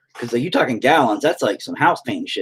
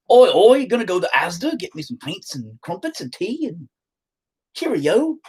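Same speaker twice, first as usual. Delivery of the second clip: put-on high-pitched, silly English accent; exaggerated English caricature, pitched way up; mock English accent; 9 semitones higher than usual. Teasing English viewers about their shopping, mock English accent. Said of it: "Y'all talking with weird accents".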